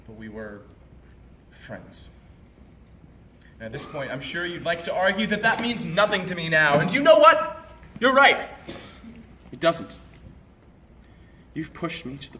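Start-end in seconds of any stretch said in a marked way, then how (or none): 0.1-2.1 low, soft, halting reminiscence; subdued regret. Acted. Telling an ex-friend what they used to be, subdued. 4.1-8.5 building to a high, loud snap; angry outburst. Acted. Conceding the friendship means nothing now, angry.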